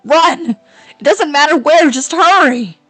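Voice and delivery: desperate shouted warning; urgent shout. Acted. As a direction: yell at her to flee now.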